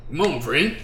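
puzzled, halting little question; confused. Acted. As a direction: voice it as if baffled. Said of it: "in a kinda confused tone".

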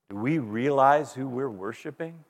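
normal talking voice

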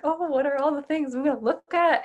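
like a child at Christmas, lightly acted; retold excited delight. Shopper walking into her favorite outdoor store, excited delight.

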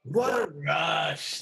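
Freddy line delivery. Retold or imitated. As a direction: savor it like Freddy. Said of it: "I think I've got the line here".

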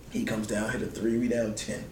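ordinary talk

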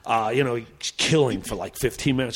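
normal talking voice

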